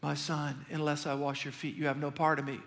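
low, soft and tender; Jesus tenderly correcting Peter, low and soft; gentle Jesus. Retold or imitated. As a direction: speak gently like a loving teacher. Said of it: "Jesus goes".

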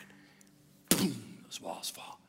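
low, slow, breathy whisper of triumph; dramatic whisper. Acted. Pastor telling church the walls fall, low slow whisper. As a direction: hush it like a secret victory. Climax of sermon point.

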